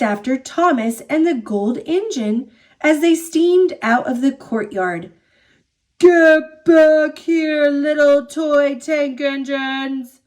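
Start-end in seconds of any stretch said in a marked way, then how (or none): 6.0-10.2 slow, loud villain call; booming Diesel Ten. A narrator's reading. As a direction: boom it after the escaping engines, drawing out the first words. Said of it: "Diesel ten's booming voice rang out".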